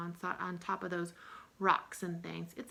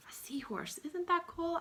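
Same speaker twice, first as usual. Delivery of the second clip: excited whisper; 8 semitones higher, 4 dB quieter than usual. Acted. Teacher delights over the seahorse.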